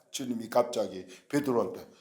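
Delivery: ordinary delivery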